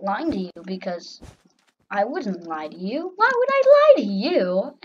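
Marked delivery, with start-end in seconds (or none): 3.2-4.8 loud, high mock indignation; sarcastic protest. Player denying lying to viewers, sarcastic protest. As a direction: squeal it in overdone innocence. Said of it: "I was being sarcastic".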